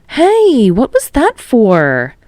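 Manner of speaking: sudden high yelp, then a slow protest; hurt and surprised after the smack; indignant yelp. Acted. Smacked on the head.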